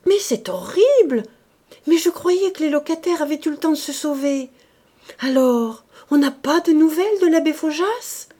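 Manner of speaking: high, loud shocked cry; cried out. A narrator's reading. Madame de Condamin crying out to the sub-prefect, shocked. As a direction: exclaim in horror about the tenants. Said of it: "Elle s'écria".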